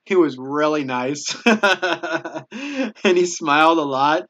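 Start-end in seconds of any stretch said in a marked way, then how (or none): 1.4-4.2 slow words through a laugh. Real, not acted. Recalling the smiling Laguna sergeant, laughing. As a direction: chuckle it out fondly.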